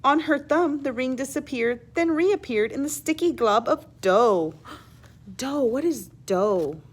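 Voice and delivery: ordinary delivery, nothing marked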